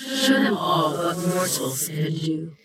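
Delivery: otherworldly, sing-song spirit voice; ghostly kami chanting its command; eerie spirit voice. A narrator's reading. Spirit speaks, far timbre.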